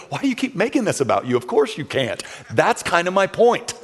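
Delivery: exasperated, rising to high emphasis; frustrated God. Acted. God answering Moses' excuses, frustrated. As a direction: scold him with mounting exasperation. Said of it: "God finally gets frustrated with him".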